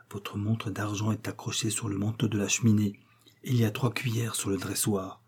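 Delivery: whispered aside, soft and confidential; low, secret voice. A narrator's reading. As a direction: whisper so only the mistress hears. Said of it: "Elle ajouta à voix basse". (chuchoté à voix basse, en confidence)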